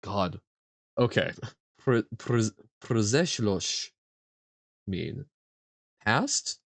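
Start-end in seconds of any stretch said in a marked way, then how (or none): none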